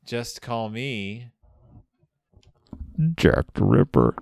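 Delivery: slow dramatic reveal. Retold or imitated. The killer reveals his name to Sir Guy, slow and ominous. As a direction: let the name land like a hammer after a hush.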